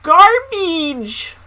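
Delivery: "Garbage!" high and loud with a long ee; exaggerated odd E. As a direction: hammer a drawn-out "-beedge" ending on "Garbage!". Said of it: "she said her E's weirdly".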